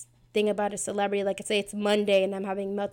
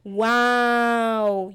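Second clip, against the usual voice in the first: mock amazed wow; 7 dB louder than usual. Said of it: "really made me go".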